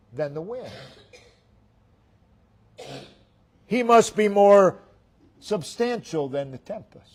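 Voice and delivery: repeated sermon cadence, swelling and sinking; rhythmic preaching. Acted. Preacher building a repeated refrain.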